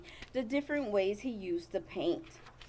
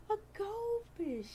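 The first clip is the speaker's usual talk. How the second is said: gasp; 11 semitones higher, 6 dB quieter than usual. Acted. Dramatic reveal reading picture book.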